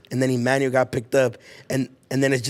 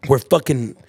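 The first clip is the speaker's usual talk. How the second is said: usual speaking voice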